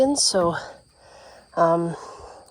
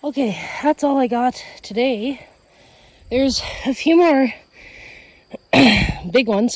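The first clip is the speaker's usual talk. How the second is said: breathless after picking pumpkins; winded, short on air; out of breath; 4 semitones higher, 3 dB louder than usual. Real, not acted. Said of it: "Sorry, I'm out of breath".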